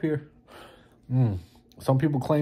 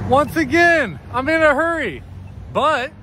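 high, loud complaint outdoors; mock-exasperated shout; 14 semitones higher, 7 dB louder than usual. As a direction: call out your grievance over the street. Shouting again at crosswalk signal.

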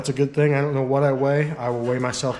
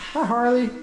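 high, cooing pet voice; sweet hello to the cat; 11 semitones higher than usual. Real, not acted. Greeting the cat.

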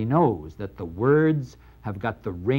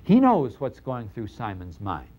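usual speaking voice

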